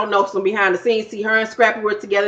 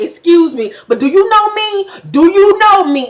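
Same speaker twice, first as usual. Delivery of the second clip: louder, higher "Excuse me, but do you know me?"; confrontational; 8 semitones higher, 5 dB louder than usual. Retold or imitated. Tommy gets in Don's face, loud, high challenge. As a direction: get in her face and demand it.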